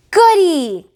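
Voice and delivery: high, loud delighted squeal; excited cry. Acted. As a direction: squeal with delight like a small child. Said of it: "cried the triplets".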